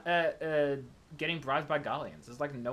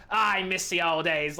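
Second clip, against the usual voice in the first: louder, slow gruff siren; gruff character voice; 5 dB louder, slower than usual. Shown as a demonstration. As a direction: drag it out in a hammed-up gruff voice. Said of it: "if I just go really gruff".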